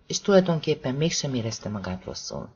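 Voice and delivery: plain reading voice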